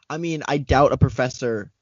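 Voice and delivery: ordinary talk, nothing marked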